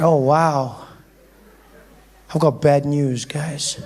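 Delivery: low, downcast delivery; reluctant bad news. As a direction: break it to friends with a sinking voice. Kid reluctantly breaks bad news to friends.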